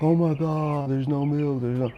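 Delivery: high, put-on frantic voice; mock the clueless shopper in a squeaky panic; hammed-up whiny panic over milk; panicky shopper. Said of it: "the same people are like".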